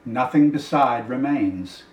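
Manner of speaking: very slow, drawn-out poetic close; poem recitation. Acted. Reader closing Shelley's poem on empty sands, slow and fading.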